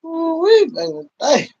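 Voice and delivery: high, loud ooh-wee; a sudden squeal of joy; excited whoop. Real, not acted. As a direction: whoop it out with delight. Excited exclamation on live.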